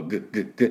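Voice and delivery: 喉の奥で短く三回「グッ」; 喉を詰めて破裂させるGの音; 喉を鳴らす音. Shown as a demonstration. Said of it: "喉をグッグッグッって鳴らして". (Gの発音練習として喉を鳴らす)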